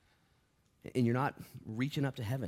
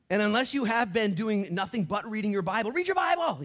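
loud, high preacher's build; preaching at full force; 13 semitones higher, 9 dB louder than usual. Acted. Preaching climax.

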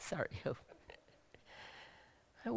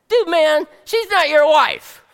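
imitated husband's outburst; 9 semitones higher, 11 dB louder than usual. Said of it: "What do you think Bob's gonna think".

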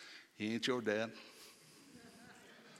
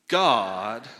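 GOD drawn out and much louder; stress on GOD; 10 dB louder than usual. Shown as a demonstration. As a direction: belt out the word God. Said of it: "put emphasis, come on, give it to me, on his name".

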